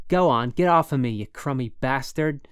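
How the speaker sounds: loud, furious, close to crying; angry, near tears. A narrator's reading. Holden pinned under roommate, loud angry shout. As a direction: shout him off your chest. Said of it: "I was almost bawling".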